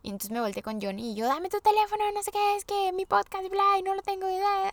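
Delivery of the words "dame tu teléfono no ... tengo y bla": high-pitched, rushed, panicky demand; frantic panic. Retold or imitated. To her husband Johnny, grabbing for his phone, frantic and high-pitched. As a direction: retell the freak-out, voice jumping way up. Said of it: "Así me volví loca".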